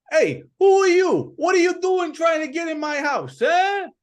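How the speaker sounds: high, loud, fast indignant yelling; angry shout. Acted. Chance yelling at a stranger at his door, high angry shout. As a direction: chase off an intruder at his door. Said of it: "he says".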